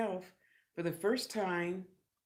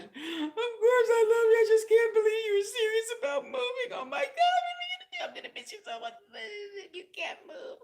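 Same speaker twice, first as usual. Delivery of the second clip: much higher, laugh-crying wail; Marcy crying; 6 dB louder than usual. Retold or imitated. Marcy sobbing after the thirty-day notice, much higher. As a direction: wail like a weepy friend.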